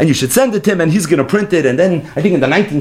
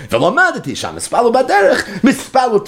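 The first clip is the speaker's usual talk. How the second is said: higher, with a laugh in it; amused, higher restatement; laughing repeat; 9 semitones higher than usual. Shown as a demonstration. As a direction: say it again, laughing at the point.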